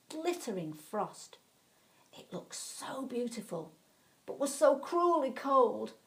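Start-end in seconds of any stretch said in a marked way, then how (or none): none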